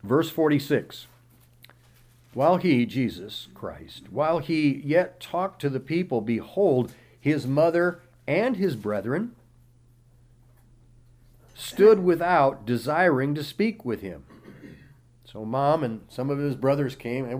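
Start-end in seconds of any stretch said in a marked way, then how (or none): 2.3-2.9 raised, declaiming Bible reading voice; scripture reading. Acted. Pastor reading Matthew aloud to the congregation, declaiming. 4.1-14.2 raised, declaiming Bible reading voice; scripture reading. Acted. Pastor reading Matthew aloud to the congregation, declaiming.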